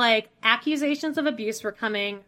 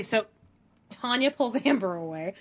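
ordinary talk

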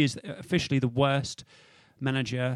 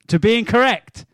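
dramatic suspense; 5 semitones higher, 7 dB louder, slower than usual. Acted. Quiz host building answer suspense.

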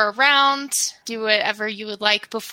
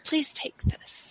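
slow, whispered offer; soft whisper; 5 semitones higher, 10 dB quieter, slower than usual. Acted. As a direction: whisper it gently to someone crying. Offering a scarf to grieving Lemon.

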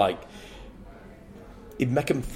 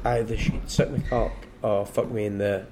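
ordinary talk

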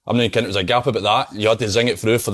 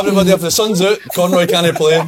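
laughing mock coach; 9 semitones higher, 6 dB louder than usual. Mimics coach mocking him.

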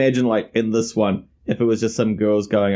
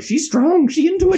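high mock voice; 3 dB louder than usual. Mocking character's thought, high voice.

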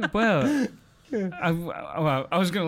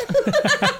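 a sudden shrieking laugh, much higher; loud high laugh; 7 dB louder than usual. Real, not acted. Comic shrieking with laughter at his own story. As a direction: burst out in a high cackle.

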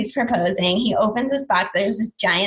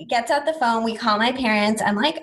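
usual speaking voice

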